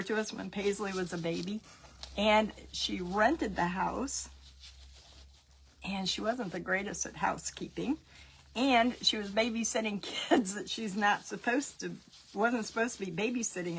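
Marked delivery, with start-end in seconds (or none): 5.9-8.0 whisper. Real, not acted. Whispering gossip about the neighbor to viewers. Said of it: "unvoiced whisper".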